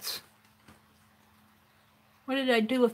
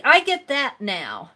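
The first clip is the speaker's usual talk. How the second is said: ordinary talk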